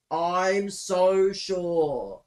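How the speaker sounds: loud, slow scoff at the excuse; sarcastic. Real, not acted. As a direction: stretch it slowly with mocking disbelief. Sarcastic reply to excuse text.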